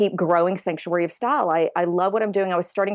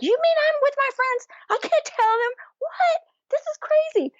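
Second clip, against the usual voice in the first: much higher, incredulous retelling; shocked disbelief. Retold or imitated. Telling producers she can't believe it, high incredulous voice. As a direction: replay the stunned reaction.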